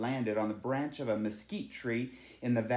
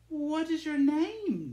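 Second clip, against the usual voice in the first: much higher and slow, tender and gentle; shy bird's question; 3 dB louder, slower than usual. A narrator's reading. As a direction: ask as if afraid to break the spell. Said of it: "Nacho asked".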